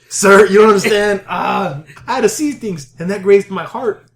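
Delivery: hammed-up, overwrought pleading; milk the trauma for sympathy; mock-tragic plea, starting loud; melodramatic victim. Milking a graze to an officer.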